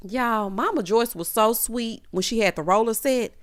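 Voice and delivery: usual speaking voice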